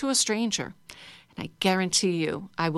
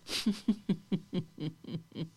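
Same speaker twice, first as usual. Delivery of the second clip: breathy soundless laugh, much softer; silent laugh; 8 dB quieter than usual. Shown as a demonstration. Teacher leading the class in silent laughter, soundless. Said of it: "I'm laughing with no sound".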